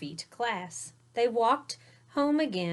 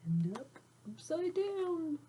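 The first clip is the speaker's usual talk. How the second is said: lilting upside-down read, pitched high and softer; playful high voice; 9 semitones higher, 5 dB quieter than usual. Shown as a demonstration. Reading aloud to kids, playful high singsong. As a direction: sing it up high like words flipping over. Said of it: "The words start like this".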